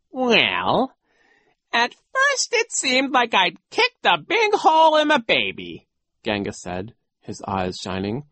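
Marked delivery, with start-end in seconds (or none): 0.1-5.9 high, loud, theatrical villain; dramatic storytelling in a raised voice; gloating villain coach. Acted. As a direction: relish the nasty tale.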